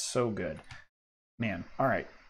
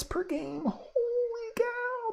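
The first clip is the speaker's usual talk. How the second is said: excited; much slower than usual. Real, not acted. Excited card reveal, pitch jump.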